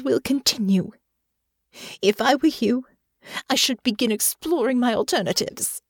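high, haughty threat; angry dismissal. Acted. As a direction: pitch up in cold indignation. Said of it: "She turned to Natasha and growled".

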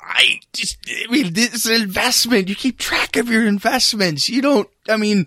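high, halting excuses; defensive fluster. Acted. Accused of following his partner, high defensive fluster.

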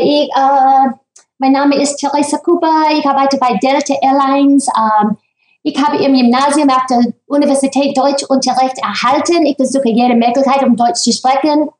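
careful German with an American accent; speaking German. Shown as a demonstration. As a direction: introduce yourself in German for the voters. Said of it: "Say something in German".

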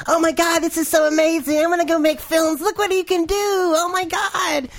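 imitating his own younger starry-eyed self, very high; old excited voice. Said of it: "that's what my voice used to sound like".

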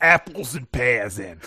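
slow, broad British slang; British accent. Acted. Co-host tosses rhyming slang into the squabble, British accent. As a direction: draw out a hammed-up British accent. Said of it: "give me your British accent again".

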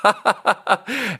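high giggling laugh; burst of laughter. Real, not acted. Recalling walking over to the customer, high giggles. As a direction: crack up mid-story.

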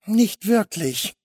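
slow, high, intimidated reply; stammering victim. A narrator's reading. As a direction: stammer it out hesitantly, pitched high. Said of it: "stammelte Louis". (eingeschüchtert stammelnd)

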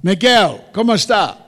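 loud, high friendly call in Spanish; loud Spanish greeting. Real, not acted. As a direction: greet the caller in Spanish, projecting.

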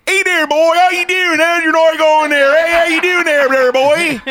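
high, loud, dopey phone greeting; mock Jim greeting the guest like a puppy; goofy Jim impression. Host imitates Jim greeting the guest on the phone, high dopey voice. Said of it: "Jim goes".

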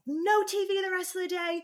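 mother punishing toddler, retold angry yell. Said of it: "And I was screaming".

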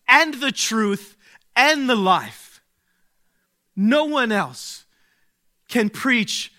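climactic preaching. Acted. Pastor to congregation proclaiming the I am sayings, at full force. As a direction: climb each line to a ringing peak.

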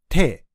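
テ released with a sharp tongue snap; quick tongue-click release; T consonant demo. Shown as a demonstration. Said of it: "舌を上顎につけて勢いよく離し". (勢いよく離す「テ」)

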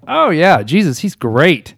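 loud, high "oh yeah", then drawn-out praise; glib enthusiasm. Retold or imitated. Man breezily assuring God he loves Jesus, glib enthusiasm. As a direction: gush a little too readily, loudly.

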